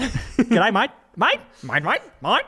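mock-Aussie twang, "mate" said like "mite"; playful Australian imitation; Australian accent. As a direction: try out a broad Australian greeting, repeating it. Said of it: "That's Australia".

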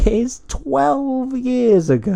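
slow, high, stretched out; drawn-out exaggeration. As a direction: stretch it for dramatic effect. Mocking old age.

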